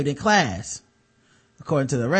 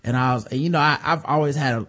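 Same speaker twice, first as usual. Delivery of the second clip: usual speaking voice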